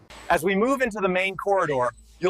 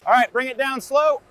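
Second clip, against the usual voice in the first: raised voice across the shop floor; calling out; 6 semitones higher, 6 dB louder than usual. Acted. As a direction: call it out loud and higher. Calling out to crane operator.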